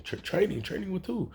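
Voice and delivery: hammed-up stammer of disbelief; startled questioning. Deku told he is about to train, startled.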